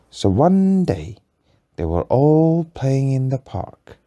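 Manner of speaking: slow, lilting storybook voice; story narration. A narrator's reading. Parent telling a bedtime story, lilting. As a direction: draw the child in with a sing-song opening.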